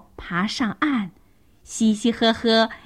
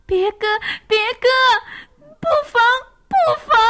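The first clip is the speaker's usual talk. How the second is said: very high-pitched little animal yelps; acted terrified child's cry; frightened squealing; 5 dB louder, much faster than usual. A narrator's reading. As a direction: squeal in panic like scared youngsters, climbing higher. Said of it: "吓得哇哇乱叫".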